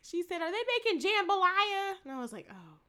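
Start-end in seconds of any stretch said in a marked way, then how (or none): 0.4-1.9 high, loud mock of her saying jambalaya; put-on high voice, mocking how she said it. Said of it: "the way she said jambalaya was so offensive". 2.5-2.8 low, very soft dismay; hushed, disapproving aside; quietly appalled. Retold or imitated. Appalled aside at offensive pronunciation.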